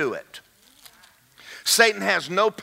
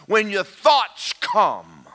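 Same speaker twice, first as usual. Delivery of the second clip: usual speaking voice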